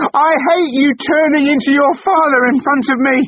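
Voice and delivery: very high, loud hateful yell; furious scream. Acted. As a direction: spit it at him. Wife screaming in quarrel.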